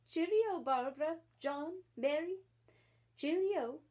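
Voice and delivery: bright, high, loud farewell; perky goodbye. Acted. Starling bids the children farewell, perkily. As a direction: chirp a jaunty cheerio. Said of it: "he said perkily".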